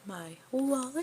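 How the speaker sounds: high, slow, puzzled question; high surprised cartoon girl. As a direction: draw it out in confused surprise. Coco surprised by wallet.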